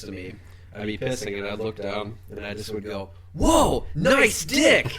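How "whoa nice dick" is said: high, loud mock-amazed shout; called out loudly. Calling out to a stranger at the urinal, loud and high. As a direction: holler it like an astonished admirer. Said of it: "I would call it out".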